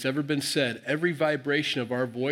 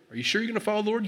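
high, doubting question; challenging; 8 semitones higher, much faster than usual. Retold or imitated. Joshua testing whether the people mean it, challenging. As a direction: press them with a raised, questioning pitch. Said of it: "he's kinda giving them a hard time".

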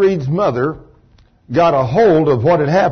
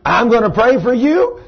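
higher, rising incredulous question; imitating someone puzzled at himself; baffled disbelief; 6 semitones higher than usual. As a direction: question your own words in astonishment. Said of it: "He thought, "Where did that come from?".

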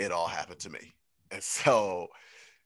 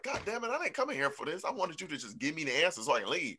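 frustrated client; 10 semitones higher, faster than usual. Retold or imitated. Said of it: "you be sitting there like".

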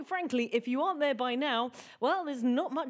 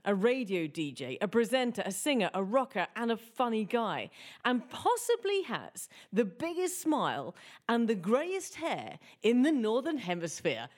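ordinary talk, nothing marked

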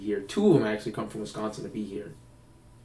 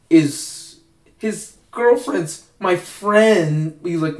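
higher, slowed, fussy imitation of a nervous guy; hammed-up nervous asker, higher voice; mocking the questioner; 5 semitones higher, 4 dB louder, slower than usual. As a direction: play up his awkward friend-of-mine question, pitched high. Guy asks the panel a question.

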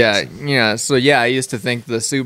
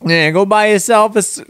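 higher-pitched mock mobster voice; Italian mobster; 8 semitones higher than usual. Failed car ad attempt, accidental Italian mobster voice. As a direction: aim for Australian, land on Italian mobster. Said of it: "I'm stuck in the Italian mobster voice".

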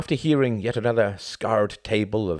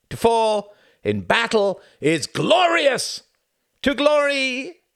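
slow, loud, high battle cry; rousing shout; 8 dB louder, slower than usual. A narrator's reading. Drinkers shouting a toast to glory. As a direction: roar it like a toast. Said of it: "they all shouted".

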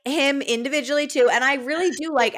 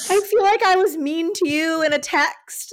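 higher, mock-tearful voice memo; lightly hammed-up crying, half laughing; imitated crying; 7 semitones higher than usual. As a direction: imitate a weepy confession with a laugh creeping in. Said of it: "crying in a voice memo".

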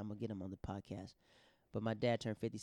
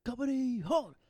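yelled command; 14 semitones higher, 8 dB louder, slower than usual. Retold or imitated. Airman calls the squadron to attention, loud military shout. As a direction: shout it high and loud across the hall. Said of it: "this guy yells".

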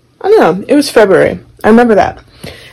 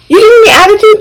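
high, loud mock scolding; angry mom imitation; 14 semitones higher, 5 dB louder, faster than usual. Mom confronting her child, mock angry. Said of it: "she's like".

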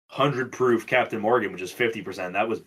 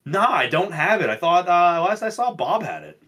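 higher-pitched mock-innocent reply; imitated excuse; 7 semitones higher than usual. Acting out a friend's return to the call, mock innocent. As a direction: play out the running joke, pitched up. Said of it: "come back and be like".

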